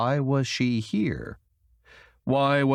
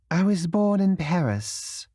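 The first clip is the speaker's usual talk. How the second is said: higher, slow, reluctant words; 8 semitones higher than usual. A narrator's reading. Madeleine answering Camille, slow and reluctant. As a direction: hold back, not trusting her. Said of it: "Madeleine's words came slowly and reluctantly".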